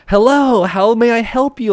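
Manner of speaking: high, over-eager shop greeting; mock an overly enthusiastic clerk's welcome; hella enthusiastic. Smoke shop clerk greeting a customer, hella enthusiastic. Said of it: "He's, like, hella enthusiastic".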